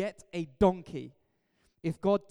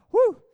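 high, loud "woo"; loud whoop; 16 dB louder than usual. Real, not acted. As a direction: let off steam with a whoop. Exhales after intense start.

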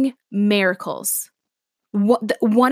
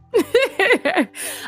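high burst of real laughter; laughing; 9 semitones higher than usual. Real, not acted. Laughs at her own tree joke.

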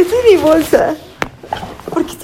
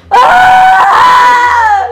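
high, loud scream, laughing; dramatic scream; 9 semitones higher, 10 dB louder than usual. Real, not acted. Caught in her role, screaming at her own drama. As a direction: let out a theatrical yell. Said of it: "Ay, estoy gritando".

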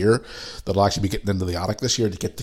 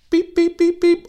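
till beeps; 4 dB louder, slower than usual. Retold or imitated. Imitating a till scanner.

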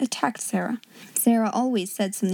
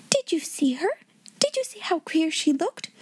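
high, hushed excited gossip; Jessie breaking out; 7 semitones higher, 4 dB quieter than usual. A narrator's reading. Schoolgirl gossiping to friends, high excited outburst. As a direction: burst out with eager curiosity. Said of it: "Jessie broke out".

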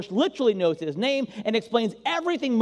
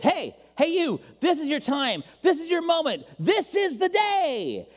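climbing higher and higher, a grand summons; excited calling; 9 semitones higher than usual. Acted. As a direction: call out like destiny knocking, building up. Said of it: "something in life says".

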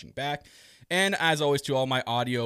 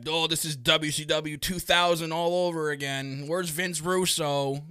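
mock whiny fan; 5 semitones higher, 4 dB louder, slower than usual. Mocking a complaining fan.

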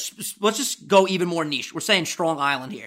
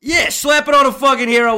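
loud, raised New York bravado; New York deli regular; 8 semitones higher, 9 dB louder than usual. Acted. Friend playing a deli customer ordering, loud New York swagger.

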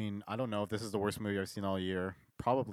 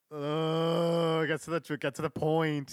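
impatient groan; 4 semitones higher, 4 dB louder, slower than usual. Retold or imitated. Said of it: "I was like".

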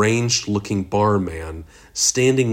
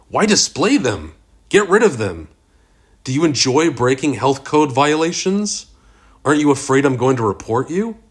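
high, raised-voice string of questions; appalled protest; 6 semitones higher, 4 dB louder than usual. A narrator's reading. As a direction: challenge him, pitch leaping in disbelief. Ira appalled, challenging the barman.

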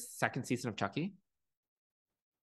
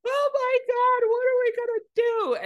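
shrill high panic impression; 5 dB louder, slower than usual. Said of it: "And she's like".

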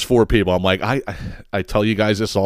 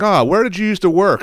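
high, chummy mock barfly; lightly voiced nosy stranger; trashy stranger; 8 semitones higher than usual. Nosy 7-Eleven regular to the teller, high chummy voice.

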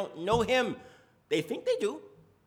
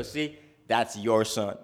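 much lower, slower accusing voice; blaming parent; 8 semitones lower, 4 dB louder, slower than usual. Retold or imitated. Parent blaming their spouse for the kids, much lower and slower. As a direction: lean on "your" and pin the blame. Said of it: "you go".

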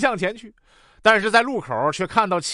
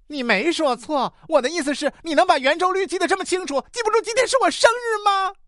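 mock indignation climbing ever higher; angry girlfriend; 8 semitones higher than usual. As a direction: build to a high, exasperated accusation. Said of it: "女朋友生气地".

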